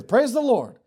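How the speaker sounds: high-pitched, bold cry of praise; unashamed exclamation. Acted. Believer reacting to good news at work, bold and unashamed. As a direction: burst out with praise, no embarrassment. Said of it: "Don't be ashamed or embarrassed to say".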